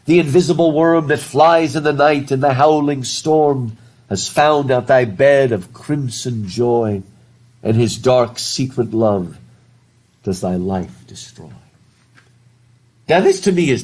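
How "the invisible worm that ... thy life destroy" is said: dramatic, louder poem recital; teacher reading Blake aloud with weight. Shown as a demonstration. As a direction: read it like an ominous incantation. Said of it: "And it says".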